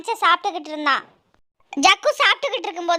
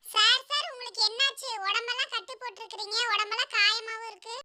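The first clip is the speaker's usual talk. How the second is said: put-on high, soft child voice; curious pupil; 3 semitones higher, 10 dB quieter than usual. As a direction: ask the teacher in a small, high voice. Pupil asking injured teacher. (உயர்ந்த, மெல்லிய மழலைக் குரல்)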